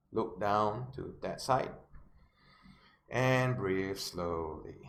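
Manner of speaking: very slow, sinking delivery. Shown as a demonstration. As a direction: stretch each word, letting the voice drop. Demonstrates sinking into depression.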